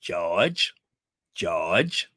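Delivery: low calling "George", rising; a hint of a sitcom wife calling her husband; lightly voiced Louise Jefferson. Dying actress calls to her husband, low and rising. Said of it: "Isabel Sanford's last words".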